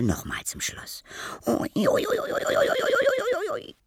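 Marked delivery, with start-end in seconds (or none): 1.5-3.8 very high, squeaky string of yelps; childlike cry. Acted. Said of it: "rief die Stimme". (sehr hohes, kindliches Gejammer)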